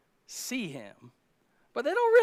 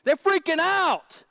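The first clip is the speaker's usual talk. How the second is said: freaking out; 11 semitones higher, 9 dB louder than usual. Acted. Disciples panicking in the storm.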